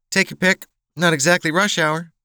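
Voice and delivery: high-pitched, wry waitress voice. Acted. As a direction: answer wryly, pitched well up, with a smile. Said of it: "replied with a wry smile".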